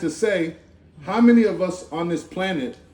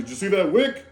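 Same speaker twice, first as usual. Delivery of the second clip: heavenly questioner. Retold or imitated. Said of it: "what do you think they're gonna ask".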